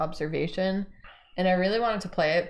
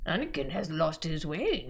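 light Yoda impression; 4 dB quieter than usual. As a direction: wise little Jedi master. Yoda quote.

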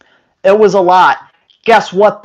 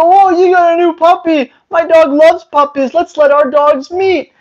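high, chirpy neighbor voice; eager dog walker; 9 semitones higher than usual. Retold or imitated. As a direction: sell the playdate brightly. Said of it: "They go".

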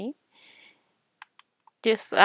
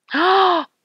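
sharp gasp; 10 semitones higher, 6 dB louder than usual. Real, not acted. Recognizes the new villager.

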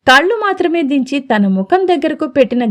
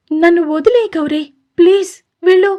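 weary plea; 6 semitones higher than usual. A narrator's reading. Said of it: "నీరసంగా వస్తున్న గొంతుతో నెమ్మదిగా".

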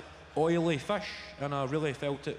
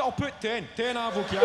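"Ten avocado" higher and louder, punched out; mock proud declaration; 7 semitones higher, 7 dB louder than usual. Upping the fake food diary to impress the trainer, loud and proud. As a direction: slam down the number like a bet.

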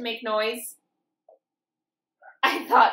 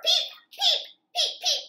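high little peeps with a laugh; chick peeps. Acted. Reading to kids, chicks peeping high. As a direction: peep like a chick, giggling.

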